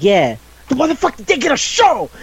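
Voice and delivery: high-pitched indignant outburst; hammed-up outraged squawk of a schoolgirl; mock outrage. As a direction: blow up in comic indignation, way up high. Retold girls' outraged complaint.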